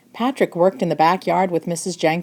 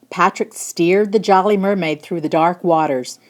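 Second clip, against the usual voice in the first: plain reading voice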